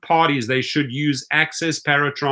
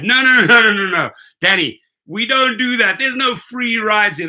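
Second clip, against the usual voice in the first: higher, louder string of no's; emphatic refusal; 7 semitones higher, 4 dB louder than usual. Real, not acted. Driver refusing a rider who wants a free ride, loud firm no's. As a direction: shut it down firmly.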